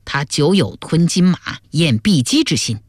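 ordinary delivery, nothing marked

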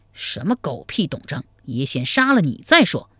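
plain reading voice

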